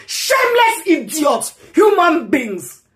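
high, hurled insult; angry shout. Real, not acted. Insulting mockers in a rant.

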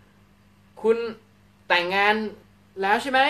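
a hint of a curious person asking if you're married; acting out a questioner. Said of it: "มีคนถามคุณว่า". (น้ำเสียงสูงขึ้นของคนที่ถามว่าแต่งงานหรือยัง)

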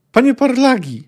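high, loud appalled shout; horrified cry. Acted. The merchant cries out at the drunk who killed the girl, horrified. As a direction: cry out his name in horror.

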